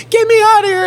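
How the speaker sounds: high, loud mock scream; imitating a wild cellmate's piercing yell. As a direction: scream it at the top of your lungs. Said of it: "starts screaming Stone Temple Pilots songs".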